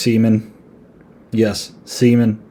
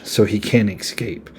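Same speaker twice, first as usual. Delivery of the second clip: normal talking voice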